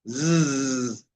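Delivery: long voiced Z buzz like a bee. Shown as a demonstration. Teacher demonstrating S between vowels, steady bee buzz. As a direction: imitate a bee with a sustained zzz. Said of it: "un sonido como de abeja".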